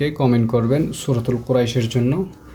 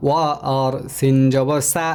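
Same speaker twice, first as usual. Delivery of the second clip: ordinary delivery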